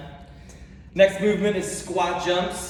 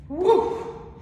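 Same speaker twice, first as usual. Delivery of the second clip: excited whoop; 4 dB louder than usual. Real, not acted. After the squat hold.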